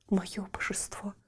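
soft unvoiced adoring whisper; whispered devotion. A narrator's reading. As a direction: breathe it to your idol. (благоговейный шёпот)

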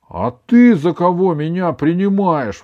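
high, loud retort; indignant. A narrator's reading. Grandson turning the grandmother's question back on her, indignant. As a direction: throw it back, affronted. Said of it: "возмутился Иван".

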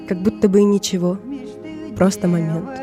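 plain reading voice

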